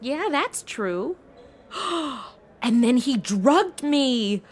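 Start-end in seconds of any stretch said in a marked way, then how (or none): 1.7-2.2 a soft, sudden intake of breath; sharp gasp. Acted. Woman realizing she was drugged, soft shocked gasp. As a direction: gasp as it dawns on you.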